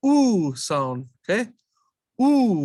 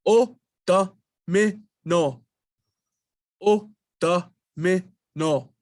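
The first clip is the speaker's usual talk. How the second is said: O domino said slowly, syllables apart: o-do-mi-no; much slower than usual. Shown as a demonstration. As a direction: sound out O domino piece by piece, slowly. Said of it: "Throw in our consonants".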